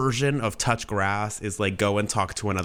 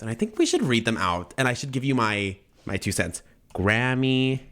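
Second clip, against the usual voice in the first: ordinary delivery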